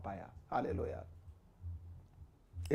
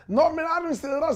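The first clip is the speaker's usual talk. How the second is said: higher, louder impatient American voice; mock American insisting; 11 semitones higher, 7 dB louder than usual. The American student correcting the puzzled preacher, high impatient mock voice.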